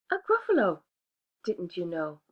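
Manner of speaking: prim little mouse declining; polite little mouse. Acted. Mouse describing monster.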